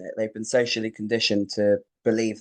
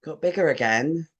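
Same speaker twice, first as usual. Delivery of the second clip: pointed, uncomplimentary tone, imitating mum; understated retold disapproval; disapproving mum. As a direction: make the remark sound like no compliment. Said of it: "It's the tone and the inflection".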